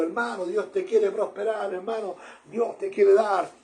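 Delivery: lightly acted rousing encouragement; prosperity preacher. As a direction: imitate a preacher stirring up the crowd. Imitating a crowd-pleasing prosperity preacher.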